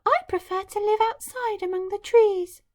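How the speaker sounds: high, light bird voice; little nightingale. Acted. The nightingale refusing the Emperor, light bird voice. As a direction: answer gently like a small bird. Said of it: "the nightingale replied".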